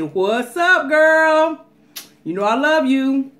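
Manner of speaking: loud, high, excited greeting. Real, not acted. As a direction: call out to her with joy. Excited shout out to a fan.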